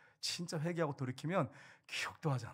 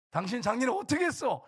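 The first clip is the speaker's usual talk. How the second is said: mock furious yell at husband, higher and louder; nagging spouse yelling; 8 semitones higher, 6 dB louder than usual. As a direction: hound your spouse like chasing down a chicken. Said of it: "이렇게 닭 잡듯이 닭기 시작하면". (배우자를 몰아세우며 호통치듯)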